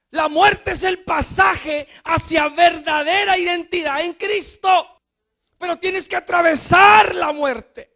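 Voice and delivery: loud, high preaching, slowing at the end; predicación enérgica. Acted. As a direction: drive the death-to-life point home. Preaching at full force. (predicación fuerte y aguda)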